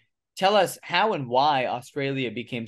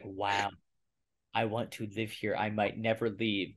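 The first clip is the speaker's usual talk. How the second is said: low, hushed reenacted wonder; quiet awe; 4 semitones lower, 8 dB quieter than usual. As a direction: drop low and soft, as if thinking it to yourself. Voices his awed first impression.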